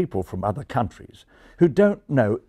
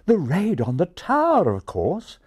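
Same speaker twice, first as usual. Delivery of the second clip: lightly voiced refined English pigeon; refined English, high at the start; refined English accent; 9 semitones higher than usual. A narrator's reading. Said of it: "replied the English pigeon".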